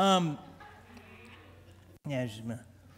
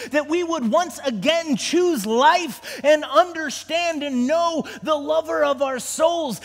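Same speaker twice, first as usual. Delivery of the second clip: louder, higher, pleading prayer; fervent prayer; 6 semitones higher, 4 dB louder than usual. Acted. Pastor leads the congregation in intercession, loud and pleading. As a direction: cry out to God with full force.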